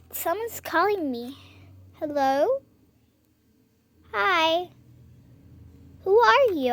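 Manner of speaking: ordinary delivery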